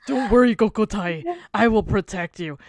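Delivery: low, steady, hammed-up heroic reassurance; mock noble older brother; protective big brother.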